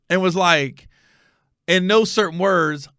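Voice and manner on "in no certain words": ordinary talk, nothing marked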